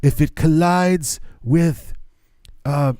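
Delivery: put-on slow, halting old-president ramble; lightly voiced Reagan losing his thread, slow; rambling Reagan impression. Mocking an elderly Reagan rambling about cars and planes, slow. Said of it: "that was Reagan towards the end".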